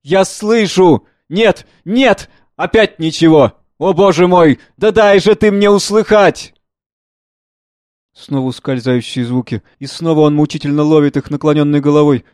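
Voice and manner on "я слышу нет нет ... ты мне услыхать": put-on slow, horrified old man straining to hear; frightened cry of an old man; terrified. Old man straining to hear the war, terrified. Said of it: "выражает ужас".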